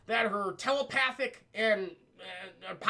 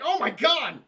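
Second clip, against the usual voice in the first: high, loud mock horror; retold shocked cry; 6 semitones higher, 7 dB louder than usual. Onlookers seeing Jill bleed, mock horrified cry. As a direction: exclaim in hammed-up shock.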